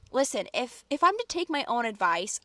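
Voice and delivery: usual speaking voice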